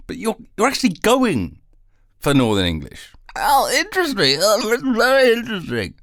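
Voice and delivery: high teasing mock interviewer voice. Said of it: "Such a shit-eating question".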